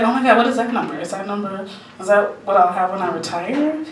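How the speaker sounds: soft, hushed question; hushed awe. Retold or imitated. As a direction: ask it quietly, in retold amazement. Retold hopeful question to financial guy.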